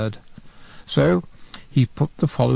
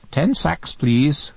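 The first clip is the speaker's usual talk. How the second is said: high squawky parrot voice, higher; 4 semitones higher, much slower than usual. Acted. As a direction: chirp it out like a talking parrot. Parrot speaks to the coalman.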